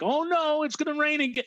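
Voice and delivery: high, anxious mock worry; worried quote. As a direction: voice a nervous viewer fretting. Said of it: "some people might actually think".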